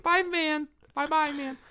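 put-on high, sing-song toddler voice; little kid voice. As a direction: babble it like a four-year-old waving bye-bye. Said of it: "Okay, little four-year-old".

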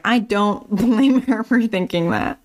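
laughing. Retold or imitated. Teller voicing her own reaction laughing.

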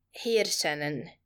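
put-on young woman offering pills, in a thick Russian accent. A narrator's reading. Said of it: "she said in a thick Russian accent".

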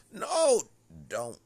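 sudden high-pitched shout; yelled refusal. Retold or imitated. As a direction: re-enact a very loud protest. Acts out his refusal.

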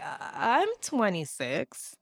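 slow, uneasy imitation; hesitant answer. Terrified man answering her question, hesitant. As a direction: draw the answer out uncertainly.

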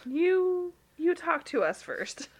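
high, drawn-out mock protest; exaggerated affronted reply; mock indignation. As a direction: stretch it out in playful disbelief.

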